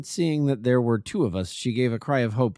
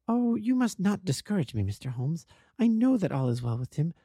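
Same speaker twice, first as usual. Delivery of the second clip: higher, earnest lady's voice; wife pleading with the detective to keep hoping; 8 semitones higher than usual. A narrator's reading. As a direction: beg him not to take her hope away. Narrator voices Mrs St Clair.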